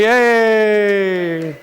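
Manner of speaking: loud, high cheer; big call-and-response yay; cheer on cue. Pretend. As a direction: shout it out for the congregation.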